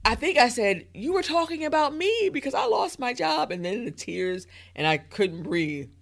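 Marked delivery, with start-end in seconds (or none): 0.9-3.5 high-pitched mock-tearful blurting; imitated hysterical crying. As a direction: wail it high, imitating your own meltdown. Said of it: "I started hyperventilating and hysterically crying".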